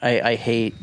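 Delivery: ordinary talk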